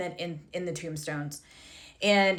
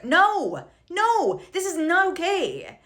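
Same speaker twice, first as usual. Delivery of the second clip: indignant no; 9 semitones higher, much slower than usual. Real, not acted. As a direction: shut it down hard.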